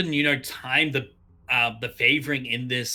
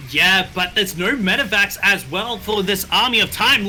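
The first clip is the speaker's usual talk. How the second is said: ordinary talk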